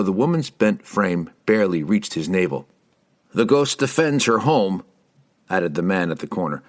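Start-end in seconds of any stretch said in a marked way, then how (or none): 3.3-4.8 higher, emphatic defence; insistent villager. A narrator's reading. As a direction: insist on it to the strangers.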